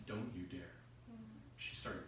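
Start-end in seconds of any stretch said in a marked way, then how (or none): 0.1-0.6 low, very soft warning; hushed admonition. Acted. Bride to her father hiding his hand, hushed. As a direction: tell him gently but firmly.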